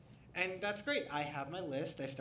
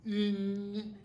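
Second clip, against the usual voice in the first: loud, hammed-up French accent; a loud mock French accent, rising to a question; terrible French accent; 6 semitones higher, 10 dB louder, slower than usual. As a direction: ham up a bad French accent. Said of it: "a terrible French accent".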